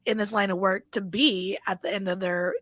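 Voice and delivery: ordinary delivery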